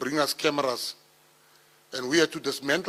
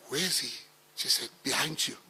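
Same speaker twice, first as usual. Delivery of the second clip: hushed; 6 dB quieter than usual. Retold or imitated. Retold hushed exchange with mother.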